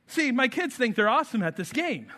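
normal talking voice

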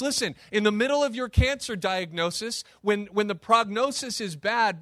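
ordinary talk, nothing marked

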